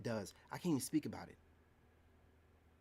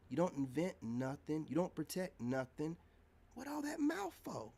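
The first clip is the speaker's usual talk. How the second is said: usual speaking voice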